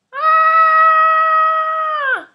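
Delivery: long high loud scream. Acted. Narrator screaming at the pasta flood. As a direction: scream in panic.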